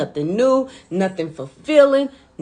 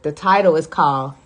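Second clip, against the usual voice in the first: ordinary talk, nothing marked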